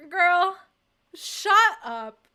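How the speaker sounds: incredulous loud shut up; loud disbelief. Real, not acted. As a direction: snap it out at the director. Outburst at director.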